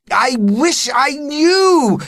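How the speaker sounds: high slow desperate cry; flustered cry. Acted. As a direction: cry it out helplessly. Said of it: "cried Mister Letitia".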